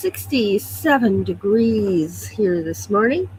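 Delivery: ordinary talk, nothing marked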